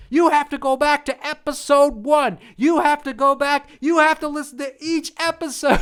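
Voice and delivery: high, loud mocking rant of an angry host. Imitating the host scolding his listeners, high loud rant. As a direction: mimic him blasting his listeners, hammed up. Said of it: "He gets angry every time".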